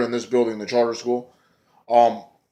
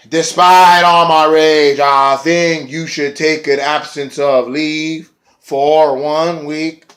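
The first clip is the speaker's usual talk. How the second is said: slow, louder droning sing-song; 3 semitones higher, 4 dB louder, much slower than usual. Retold or imitated. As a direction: drawl it out like a grunge lyric. [name] quoting his song lyric.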